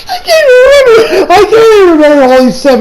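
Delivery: high, helpless laughing speech; cracking up mid-sentence; real laughing. Real, not acted. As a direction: squeal it through a fit of laughter. Cracks up at own rant impression.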